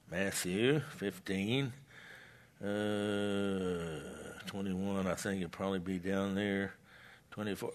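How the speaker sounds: low, soft murmur while hunting for the page; talking to himself. Real, not acted. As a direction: think aloud quietly as you search. Searching the Bible, talking to himself.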